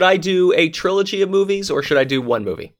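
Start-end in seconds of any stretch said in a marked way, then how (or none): none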